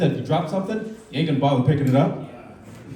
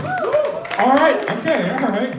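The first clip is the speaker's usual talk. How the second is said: hype up crowd; 10 semitones higher, much slower than usual. Real, not acted. Hyping crowd.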